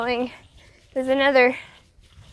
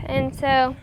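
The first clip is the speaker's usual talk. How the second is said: normal talking voice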